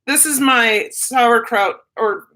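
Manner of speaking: ordinary delivery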